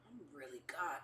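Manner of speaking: hushed, awed whisper. Retold or imitated. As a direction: breathe it in disbelief. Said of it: "you start looking at your hands like".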